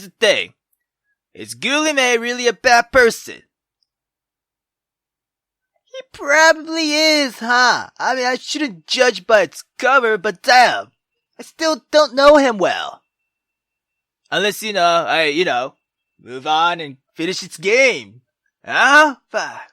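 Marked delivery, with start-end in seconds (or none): none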